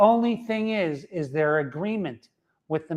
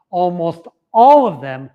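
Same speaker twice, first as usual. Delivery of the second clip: emphatic louder; 10 dB louder than usual. Shown as a demonstration. Repeats phrase louder.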